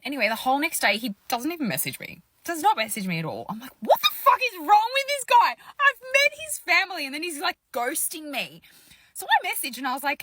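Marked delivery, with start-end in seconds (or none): 3.8-8.6 outraged. Retold or imitated. Retold outraged thought.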